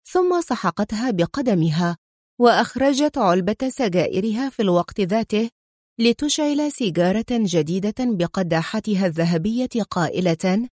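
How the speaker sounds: ordinary delivery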